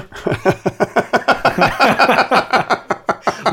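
long high unhinged cackle; maniacal laugh. Real, not acted. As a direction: cackle like a crazed villain. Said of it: "That was the most maniacal laugh".

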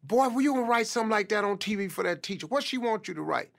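lightly voiced scolding mother; fussing mom. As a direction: fuss at him quickly like a mother. Mother scolding her son.

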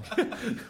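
sudden high giggle; high laughing burst. Real, not acted.

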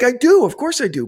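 husband answering his wife's scolding, loud defensive protest. Retold or imitated. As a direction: blurt it out defensively. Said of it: "I'm like".

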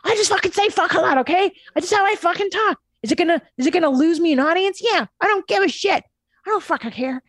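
high, fast, amped-up rant; wound-up Gary. Retold or imitated. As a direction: rant like an over-caffeinated hustler. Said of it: "Gary's way more wound up than me".

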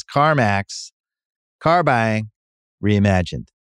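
smooth, even sponsor read. Acted. Podcast host reading the CarMax sponsor spot, smooth ad read.

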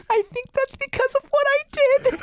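very high, small guilty voice; mock guilty whimper. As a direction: squeak it out like a sheepish child. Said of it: "she's like".

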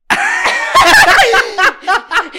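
long, high, loud cackle; a long, high, loud cackle at the mugshot memory; loud laugh. Real, not acted. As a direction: howl with laughter. Cracking up at own story.

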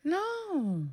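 high, breathy, soft gasp of a no; shocked no. Real, not acted. Cohost hearing of the broken neck, shocked soft cry. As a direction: recoil at the gore. Reacting to the gruesome death.

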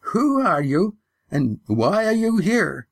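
put-on high, slow challenge from an old woman; the old grandmother confronting strangers, high and slow. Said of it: "The woman who spoke was the last".

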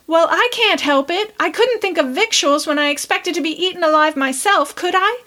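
put-on high, pleading girl's voice. A narrator's reading. Prue pleading with her sister over the burnt dinner, high and pleading. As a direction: beg to be let off. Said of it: "pleaded poor Prue".

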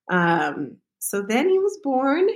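usual speaking voice

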